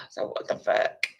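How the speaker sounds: breathy whispered aside. Real, not acted. Aside to the listener, a whisper. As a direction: drop to a whisper.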